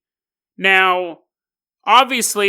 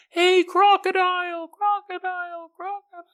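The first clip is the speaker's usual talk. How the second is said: much higher call fading away to nothing; fading echo call; 12 semitones higher, 3 dB quieter than usual. Acted. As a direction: call out and let it die off like an echo. Boy calls out from the shore.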